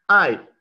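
ai said high and loud with a falling tone; Mandarin syllable. Shown as a demonstration. Teacher modelling the second word ai for students, high and falling. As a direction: call out ai as a sharp falling syllable. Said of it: "La segunda".

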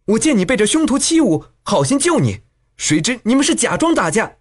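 high-pitched, wronged protest; angry. A narrator's reading. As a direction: protest indignantly that your help was abused. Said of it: "肖钟会怒道".

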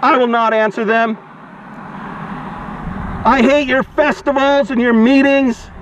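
ordinary talk

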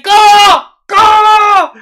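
very high, loud "what?!"; shocked scream. Acted. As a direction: shriek in stunned disbelief. Screams shocked reaction to the scene.

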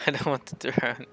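normal talking voice

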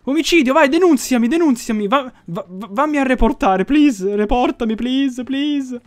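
high, excited, comic begging; mock pleading. Gamer begging witnesses to report him, excitedly. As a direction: urge them on in a loud high voice.

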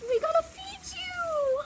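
slow, high sing-song to a pet. Real, not acted. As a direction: coo it slowly at the dog. Child talking to dog.

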